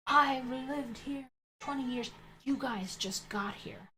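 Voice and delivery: high, slow mock-indignant neighbour; imitated flip-out. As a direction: draw out her outraged complaint, mockingly. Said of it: "She just flips out and starts going".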